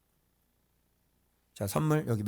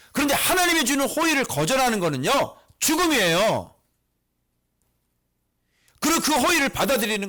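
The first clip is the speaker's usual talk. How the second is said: loud, high-pitched urgent exhortation; impassioned preaching; 9 semitones higher, 5 dB louder than usual. Real, not acted. Preacher challenging congregation over their grumbling, loud impassioned exhortation. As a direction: drive each question home at full force. Preacher declares climax at full force.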